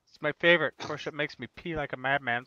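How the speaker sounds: ordinary delivery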